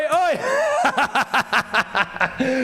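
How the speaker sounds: high excited laugh. Real, not acted. Laughing at chance.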